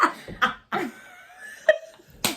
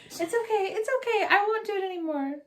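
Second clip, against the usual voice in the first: soothing the dog; 6 semitones higher, faster than usual. Real, not acted. Calming the dog.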